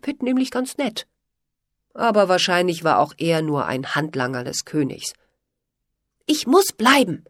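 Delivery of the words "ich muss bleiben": stubborn, raised and firm; defiant. A narrator's reading. Polly refuses to leave, defiantly. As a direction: dig your heels in. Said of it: "sagte Polly trotzig".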